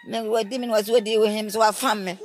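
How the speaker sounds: retold protest. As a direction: plead your case to your mother.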